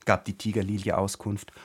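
ordinary delivery

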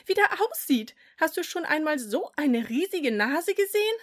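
gleefully pointing out the nose; giggly boy voice. A narrator's reading. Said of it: "gluckste er".

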